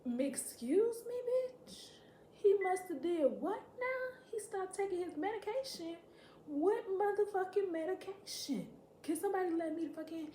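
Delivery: hammed-up high-pitched shock; incredulous outburst. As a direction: stretch the words in outraged astonishment. Acts out shocked reply to sister.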